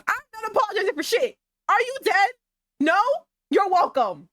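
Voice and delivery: sudden high, loud, fast blast of defiance; loud outburst. Acted. Streamer defending her game choice to chat, loud outburst. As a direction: explode at them for no reason. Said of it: "a really loud outburst".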